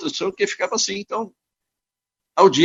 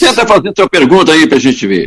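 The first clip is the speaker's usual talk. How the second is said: louder, to be heard on a choppy call; talking loud over a bad line; 10 dB louder than usual. Real, not acted. Guest asking the interviewer over a breaking line, loud. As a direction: push the voice so it carries down the line.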